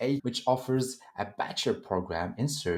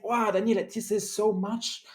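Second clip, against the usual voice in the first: mock amazed, higher pitch; excited voice; 8 semitones higher than usual. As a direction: play an overwhelmed listener. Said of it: "And now you might say".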